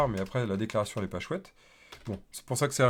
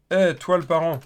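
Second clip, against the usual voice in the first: bright, louder shout toward the parent, higher up; playful call-out; 7 semitones higher, 11 dB louder than usual. Pretend. Teacher jokingly calling out to the parent component, playful loud hail.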